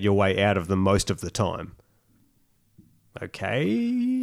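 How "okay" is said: high drawn-out okay; sarcastic okay. Real, not acted. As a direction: stretch the okay up high, mocking. Mocking after negotiate laws.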